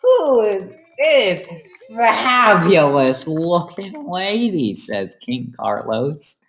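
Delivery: high, slow, hammed-up smarmy king; mock suave king chatting up a lady; flirty king voice. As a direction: drawl it out like a vain flirting royal. Voicing King Carlos flirting.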